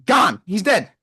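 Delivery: ordinary delivery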